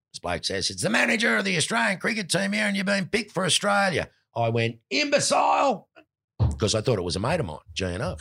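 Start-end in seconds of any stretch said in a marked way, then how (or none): 0.7-4.1 very high-pitched put-on voice; excited messenger impression. Roommate passes on selection news, high put-on voice. Roommate relays a phone message. 4.9-5.9 very high, exclaimed retort; mock shouted insult. Player thinks a mate is pranking him, high shout.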